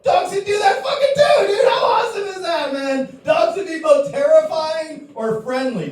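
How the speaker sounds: rally the crowd with laughing shouts; excited shouting. Acted. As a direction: shout it like a fired-up debater. Shouting hype in comedy debate.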